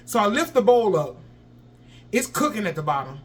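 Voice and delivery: ordinary delivery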